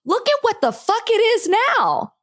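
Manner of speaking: high-pitched angry outburst; exasperated. Real, not acted. Podcaster points at the country now, exasperated. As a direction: throw it out in real exasperation.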